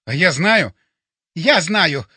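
loud, triumphant announcement. A narrator's reading. Colonel knows how to light the paper, triumphant. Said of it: "торжествующе объявил полковник".

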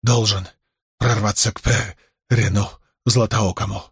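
broken, breathless rasp; gasping hoarse. A narrator's reading. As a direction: force each word out, wounded. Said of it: "задыхаясь, прохрипел раненый".

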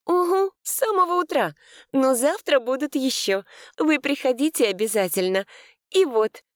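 high bright saleswoman voice; cheerful shopkeeper. A narrator's reading. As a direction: chirp it to customers.

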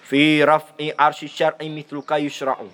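every syllable clear and slow; slow careful recitation. Shown as a demonstration. Teacher showing students a clean, slow recitation. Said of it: "Seperti ini".

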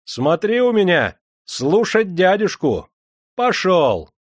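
high, loud calling out; commanding holler from a carriage; shouted orders. A narrator's reading. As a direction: shout so the uncle overhears. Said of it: "крикнул, чтобы услышал Никита Никитич".

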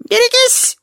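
very high-pitched squeal; piercing squeal of warning. A narrator's reading. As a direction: shriek it as you swing into battle. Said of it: "ещё раз взвизгнул Вонючка".

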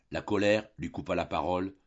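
usual speaking voice

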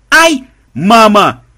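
very high, loud shriek of fright; frightened scream. Acted. Granddaughter shrieking at the monster swarm, loud and high. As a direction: shriek for your mother.

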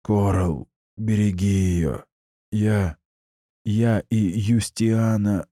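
dying rasp. Acted. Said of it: "снова прохрипел дядя".

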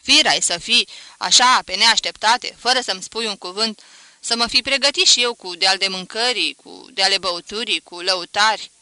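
loud, astonished motherly telling-off; flustered scolding. A narrator's reading. Astonished mother scolding son for no warning, loudly. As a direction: keep scolding him, loud and put out. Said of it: "își certa mai departe feciorul".

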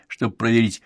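usual speaking voice

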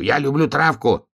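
high, earnest protest; pleading babble. A narrator's reading. Donkey cornered by bear cub, high pleading. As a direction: insist on it, pitched high. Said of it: "бормотал ослик".

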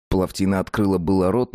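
usual speaking voice

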